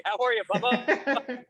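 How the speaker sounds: slow, high pet talk; talking to a cat. Real, not acted. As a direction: coo at the cat, drawn out. Greeting the interviewer's cat.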